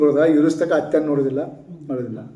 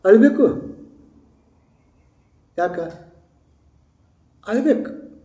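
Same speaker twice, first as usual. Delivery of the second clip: mock insistent opinion; insistent other voice; 6 semitones higher than usual. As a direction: press the point in a raised quoted voice. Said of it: "ಮತ್ತೊಬ್ರು ಅಂದ್ರು".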